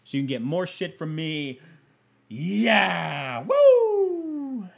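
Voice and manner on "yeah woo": shouted cheer rising to falsetto; holler, then whoop very high and long. Pretend. Cheering his own Patreon plug, whooping cheer.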